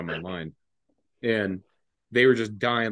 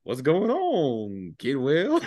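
slow, drawn-out, swooping question; long sing-song greeting; amused sergeant greeting; 4 semitones higher, much slower than usual. Retold or imitated. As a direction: stretch the words out knowingly, imitating an amused superior. Sober sergeant greets a drunk marine.

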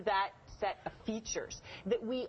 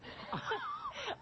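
a real burst of high, soft laughter; helpless giggle; 5 dB quieter than usual. Real, not acted. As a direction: crack up at the clip. Anchor laughs at the clip.

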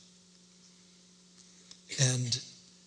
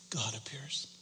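normal talking voice

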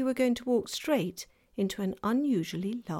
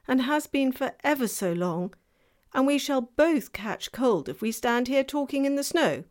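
fawn voice; 5 semitones higher, 7 dB louder than usual. Acted. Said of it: "said Mister Tumnus".

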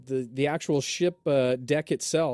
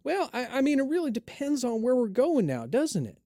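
higher musing haggler voice; Jed haggling; 9 semitones higher than usual. Acted. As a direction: stall while haggling. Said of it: "as he does".

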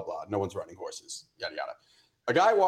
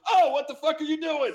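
high-pitched outburst, suddenly exploding; retold yell; 14 semitones higher than usual. Yelling at a guy walking off with the beer, retold outburst. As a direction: reenact yelling at a thief, voice leaping up. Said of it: "just yelling at this guy".

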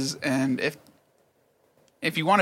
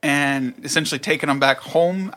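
ordinary talk, nothing marked

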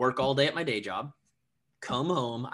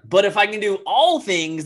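much louder, higher comeback, "all" punched; emphatic self-rebuttal; 8 semitones higher, 12 dB louder than usual. Retold or imitated. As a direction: argue back at your doubt, loud and high. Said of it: "I'd be like".

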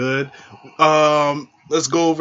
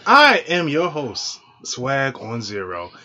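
dramatic slow intro; much slower than usual. Shown as a demonstration. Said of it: "I was trying to make it thrilling".

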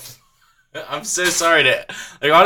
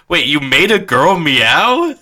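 slow, drawn-out disbelief, rising on "meow"; stunned retold question with a laugh in it; incredulous; slower than usual. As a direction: stretch the question out in mock astonishment.

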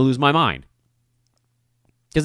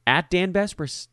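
ordinary talk

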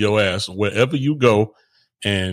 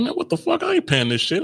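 indignant younger self. Retold or imitated. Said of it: "I was like".

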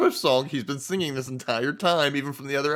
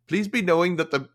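Indian accent impression; 5 semitones higher, 5 dB louder than usual. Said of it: "I'm gonna do the impression of him".

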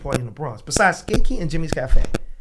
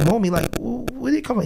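surprised; 10 semitones higher than usual. Retold or imitated. Retelling his reaction to name.

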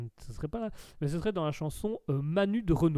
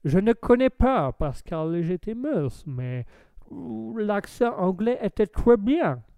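put-on loud, slow English-accented French; mock English lord, drawn-out syllables; 3 semitones higher, 6 dB louder, slower than usual. Streamer's English lord judges the accent, hammed-up English accent. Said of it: "accent anglais, euh, my lord".

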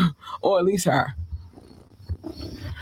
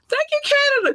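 squeaky falsetto thanks; faster than usual. Acted. Thanking Canadian listeners in a very high squeaky falsetto.

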